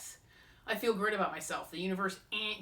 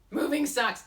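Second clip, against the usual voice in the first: higher, louder, but quicker than the wail; loud complaint; 8 semitones higher, 8 dB louder, slower than usual. Acted. Vlogger repeats her moving complaint, louder. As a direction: blurt the complaint again. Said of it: "What is my attitude about my move?".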